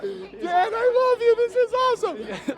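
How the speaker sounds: high, loud, tearful joy, slow on the last line; a thrilled fan crying out love for his idol; overjoyed. Real, not acted. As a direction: gush with thrilled, near-crying delight. Thrilled by a text from Dan.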